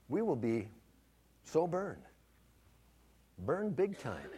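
hushed pulpit reflection; quiet preaching. Acted. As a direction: drop the voice and press the lesson home. Sermon application after story.

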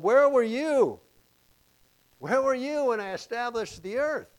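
high, ringing questions, loudest at the outset; God challenging Job. Acted. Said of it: "He says to Job".

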